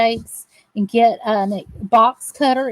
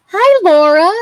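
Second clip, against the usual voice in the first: bright, louder hello; loud greeting; 6 semitones higher, 8 dB louder than usual. Real, not acted. Greeting Laura as she comes in, louder. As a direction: call out hi.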